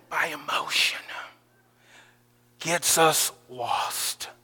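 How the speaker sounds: whispered, slow sermon line; preacher landing the lesson softly; hushed point. Real, not acted. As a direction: drop to a whisper to land it.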